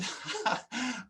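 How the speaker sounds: soft laugh. Real, not acted. Laughs recalling arguing with nurse.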